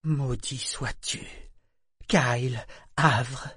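slow, heavy curse full of hatred; low hateful curse. Acted. Figurehead cursing Kyle, slow and hateful. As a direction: spit the curse out slowly. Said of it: "d'une voix basse et pleine de haine".

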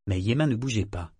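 ordinary delivery, nothing marked

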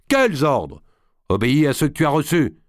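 high, irritated command; angry retort. A narrator's reading. D'Artois leaving in a rage, rebuking Berzumé, angry retort. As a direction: throw the question back and order him angrily. Enraged lord snapping at his officer.

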